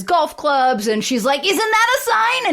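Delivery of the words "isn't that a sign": very high, hammed-up demand; mock exasperated wife. Retold wife challenges husband tripping over golf clubs, mock exasperation. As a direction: imitate an indignant wife way up high.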